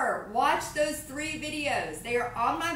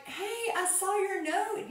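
tender greeting; 8 semitones higher, slower than usual. Retold or imitated. To her daughter on the school run, a high tender coo. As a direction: coo it gently to your daughter.

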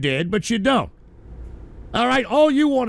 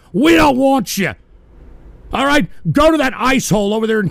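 louder furious shouting; angry shout; 4 dB louder than usual. Real, not acted. Streamer yelling at draft-dodging chatters, furious and loud. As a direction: bark it at them like a drill sergeant.

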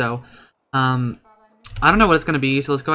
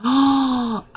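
shocked gasp; 11 semitones higher than usual. Real, not acted. Shocked at game event.

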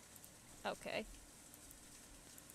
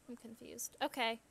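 ordinary talk, nothing marked

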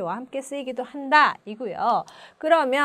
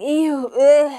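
loud, higher eww and ugh; disgusted; 4 semitones higher, 9 dB louder, much slower than usual. Shown as a demonstration. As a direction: recoil from something gross. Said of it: "That's disgusting". (역겨워하며)

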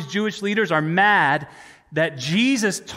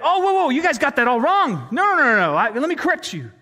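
high, loud, flustered interruption; hammed-up rush to correct everyone; mock frantic backtracking; 7 semitones higher, 4 dB louder than usual. Preacher imagines Jesus retracting his claim to the leaders, mock frantic backtracking.

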